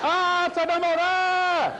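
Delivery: high, loud panicked shriek; hysterical scream. Retold or imitated. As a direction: shriek it like hysterical old women. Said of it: "We screamed like four hysterical old women".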